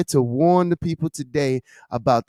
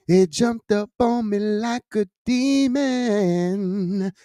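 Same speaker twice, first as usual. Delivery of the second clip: slow, higher, stretching every word; half-chanted, slow and raised for drama; dramatic drawn-out repeat; 4 semitones higher, much slower than usual. Real, not acted. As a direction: draw "demon" right out for effect.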